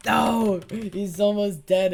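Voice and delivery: real loud cry of dismay; frustrated shout. Real, not acted. Gamer missing a kill on an almost dead opponent, frustrated shout. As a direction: yell it high, stretching the words out. Loses a nearly won fight.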